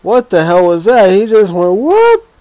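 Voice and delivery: shocked yells. Real, not acted. Gamer yelling as a receiver blows past. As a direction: shout in shock as he gets beat. Watching a player get burned.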